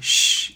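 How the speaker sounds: shh as a long hissed sh, no voice; sh sound. Shown as a demonstration. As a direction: hold out the sh in shh softly. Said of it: "try to say an S H sound".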